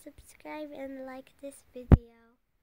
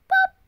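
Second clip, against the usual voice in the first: high, loud popping noise; sudden loud high pop; balloon pop; 12 dB louder than usual. Acted. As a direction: burst it out like a balloon. Balloon bursts.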